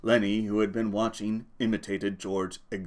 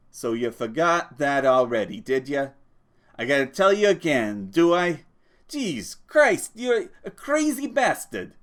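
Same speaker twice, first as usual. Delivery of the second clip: rising into a high, loud exasperated rant; 4 semitones higher, 5 dB louder than usual. Acted. George berates forgetful Lenny, exasperated and rising. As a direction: scold him, fed up.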